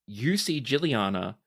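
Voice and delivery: ordinary talk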